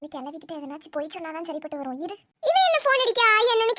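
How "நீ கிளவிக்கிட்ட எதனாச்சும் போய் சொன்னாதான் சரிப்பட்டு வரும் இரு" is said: quiet aside. Acted. Aside muttered to self.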